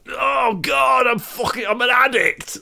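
wailing London bloke; London geezer accent. Acted. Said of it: "something took me over there".

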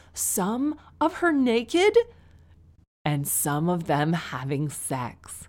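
slow, scandalised reading; dramatic slow reveal. Acted. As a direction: drag out each shocking word. Reading the shocking email line.

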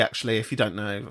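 ordinary delivery

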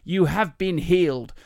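slow, high American faith healer; drawn-out American preacher's proclamation; slight American accent. Acted. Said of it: "that's a slight American accent".

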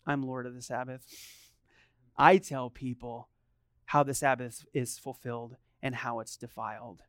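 low, soft, measured declaration; hushed, unhurried authority with pauses; quiet authority. Acted. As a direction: answer squabbling children with calm command. Voices Jesus' response to bratty Pharisees.

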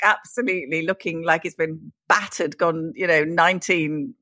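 ordinary talk, nothing marked

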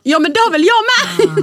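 put-on childish indignant retort; whiny daughter. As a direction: daughter indignantly answering her mother. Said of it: "Man bara".

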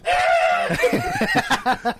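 high-pitched mock shriek; Rob's scream. Storyteller imitates his friend as the snake lunges, mock scream. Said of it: "And Rob just goes".

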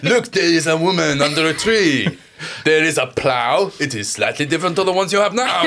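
very bad French accent. Podcaster voicing the chimney sweep showing the past, bad French accent. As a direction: announce it like a bad stage Frenchman. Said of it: "very bad French accent".

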